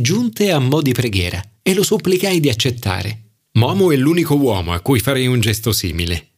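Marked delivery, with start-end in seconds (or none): none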